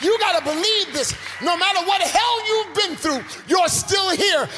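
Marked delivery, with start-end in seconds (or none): none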